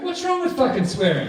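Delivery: high mock indignant protest; outraged player. Player defending his swearing to staff, high mock outrage. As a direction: fire it back up high and offended.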